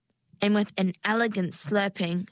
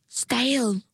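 usual speaking voice

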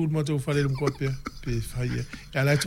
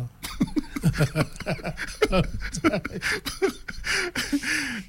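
high, sustained burst of laughter; real drawn-out laughing fit; long laugh; 10 semitones higher, 3 dB quieter than usual. Real, not acted. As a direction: laugh at length, high-pitched. Banter about favourite rugby teams.

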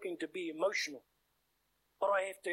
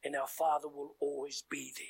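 soft, hushed prayer voice, dropping much softer; closing prayer; 3 dB quieter than usual. Acted. Preacher praying over the saints, soft and hushed.

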